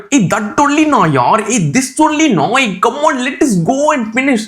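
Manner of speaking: very high, exaggerated Indian English; hammed-up comic Indian accent. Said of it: "everybody is talking like this".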